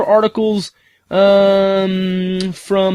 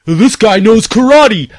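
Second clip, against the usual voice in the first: movie-quote karate line; 3 semitones higher, 5 dB louder, slower than usual. Retold or imitated. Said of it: "just like people say karate".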